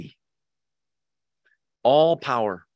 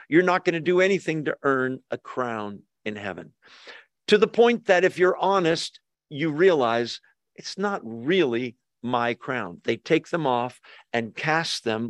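normal talking voice

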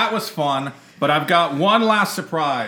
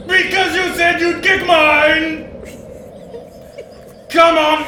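higher and louder, taunting; Robert Frost ghost; 11 semitones higher, 4 dB louder than usual. Acted. Ghost poet goading co-host to fight, high and loud. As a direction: call her out to fight, hammy. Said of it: "Robert Frost".

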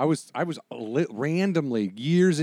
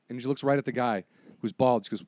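ordinary talk

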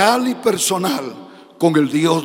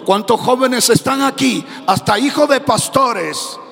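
full-force preaching; 3 semitones higher than usual. Acted. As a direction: preach it out to the young people, climbing in force.

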